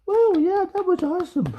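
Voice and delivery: mocking dazzled viewers, hammed-up high awe; mock awed fan. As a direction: gush like an impressed fan, hamming it up. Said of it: "expect people to go like".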